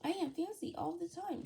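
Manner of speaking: ordinary delivery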